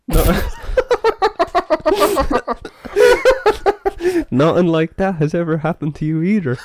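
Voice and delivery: reading the punchline through laughter; can barely get the last line out for laughing. Real, not acted. Host cracking up while reading the joke's punchline.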